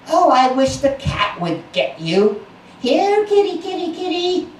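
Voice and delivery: high, sing-song mock call to the cat; taunting sibling. A narrator's reading. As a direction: tease the duckling, calling the cat.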